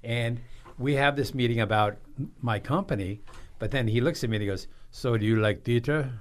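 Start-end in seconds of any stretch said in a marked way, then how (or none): 4.9-6.1 put-on light French-accented question; a hint of a French banker asking. Banker meeting him, asked in a French accent. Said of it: "with this French accent".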